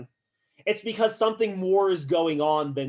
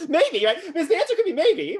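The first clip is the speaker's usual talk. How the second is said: laughing; 12 semitones higher, 5 dB louder than usual. Real, not acted. Teacher laughs at student's answer.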